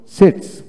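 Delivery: "সিটস" opening on a dental s. Shown as a demonstration. Teacher giving an example of word-initial S, "সিটস" with dental s. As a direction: say "সিটস" with s, not sh, at the start. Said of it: "এস দন্তাস্য হয়".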